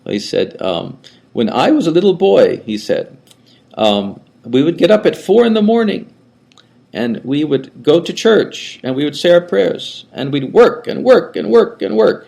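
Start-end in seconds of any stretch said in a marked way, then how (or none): 1.3-2.6 put-on high, thin old man's voice with a German lilt; an elderly Austrian monk recalling his boyhood; old monk's high voice. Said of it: "he had a high voice". 4.4-12.2 put-on high, thin old man's voice with a German lilt; an elderly Austrian monk recalling his boyhood; old monk's high voice. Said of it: "he had a high voice".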